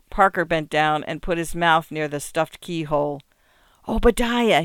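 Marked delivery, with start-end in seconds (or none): none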